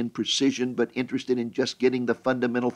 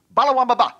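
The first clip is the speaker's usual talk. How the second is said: loud, high burst of mock gibberish; mock interpreter; 11 semitones higher, 8 dB louder, faster than usual. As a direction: blurt it out as one curt translation. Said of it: "the interpreter will s-, simply say".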